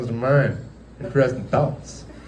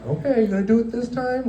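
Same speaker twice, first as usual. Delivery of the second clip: higher, teasing disbelief; sarcastic challenge; 12 semitones higher than usual. Retold or imitated. Mocking a partner who keeps threatening to leave, sarcastic. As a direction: dare him to actually go.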